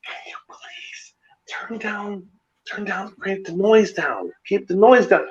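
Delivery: barely voiced, hushed little old lady asking nicely; soft polite plea. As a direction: whisper it sweetly, like a timid old lady at the door. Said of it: "She says real nicely".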